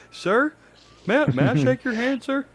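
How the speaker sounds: high, eager, pleading voice; eager follower. Pretend. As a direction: beg to shake his hand.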